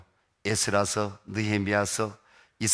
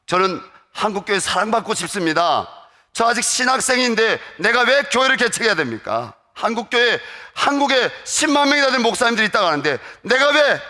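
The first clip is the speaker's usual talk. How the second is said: loud, high retold protest; protesting God; 8 semitones higher, 8 dB louder than usual. Seminary student protesting to God about planting a church, loud. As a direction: argue back at God, voice climbing. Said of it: "하나님께 항변했어요".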